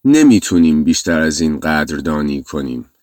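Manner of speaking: ordinary talk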